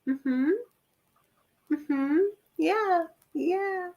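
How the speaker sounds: high, noncommittal humoring hums; mock polite agreement. Listener humoring the vintage-fashion girl, mock agreement. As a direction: humor them in a small, high voice. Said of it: "you're like".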